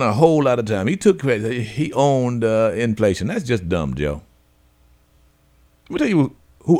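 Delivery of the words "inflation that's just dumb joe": ordinary talk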